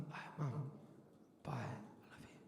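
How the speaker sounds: very soft, low goodbye; barely voiced, gentle parting words; tender farewell. Grown son saying goodbye to his old mum, tender hush.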